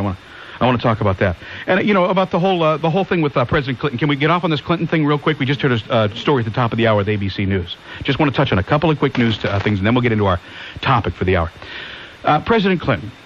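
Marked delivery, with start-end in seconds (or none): none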